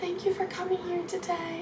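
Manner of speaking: very soft, high voice. Retold or imitated. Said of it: "she has such a soft voice".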